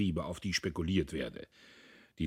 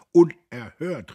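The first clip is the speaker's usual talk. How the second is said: loud, slow, higher cry of outrage; outraged cry; 7 semitones higher, 11 dB louder, slower than usual. A narrator's reading. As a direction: hurl it like an offended crowd. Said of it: "riefen die Lieferanten".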